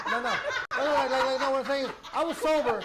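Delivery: loud, high-pitched insistence over the laughter; laughing protest. Real, not acted. Teased man insisting he was sober, loud high protest. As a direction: protest loudly through a laugh.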